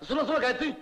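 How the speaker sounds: high, hasty calling out; flustered protest. Acted. Old patient alarmed secretary may quit, flustered and high. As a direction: stop her from leaving.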